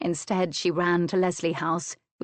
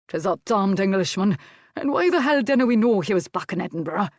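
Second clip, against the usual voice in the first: loud, raised Scots outburst; angry swearing; 2 semitones higher, 5 dB louder than usual. A narrator's reading. Master curses on hearing of the abduction, angry. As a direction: curse the news in a heated rush. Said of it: "Adam Leslie swore".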